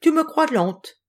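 high, slow, teasing challenge; challenging. A narrator's reading. Turtle dares the antelope, slow teasing challenge. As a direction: dare her, drawing the words out. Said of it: "la tortue défia l'antilope".

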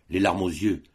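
ordinary delivery, nothing marked

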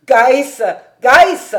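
loud, high shout for a servant; calling out. A narrator's reading. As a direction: call him from afar, rising. Hahn calls his assistant. (appeler quelqu'un en criant)

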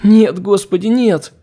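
high, loud desperate pleading; terrified whimper. Acted. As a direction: whine in panic, begging. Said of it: "Надрывно заскулила Мше".

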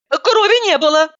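pitched high, precise correction; high girlish voice. Acted. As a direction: her heroine's high voice. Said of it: "уточнила я".

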